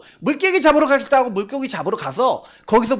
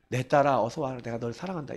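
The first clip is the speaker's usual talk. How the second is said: hushed, fatherly welcome, much softer; God's tender voice; 7 semitones lower, 9 dB quieter than usual. Retold or imitated. God welcoming a hurting daughter, soft and tender. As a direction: call her home gently.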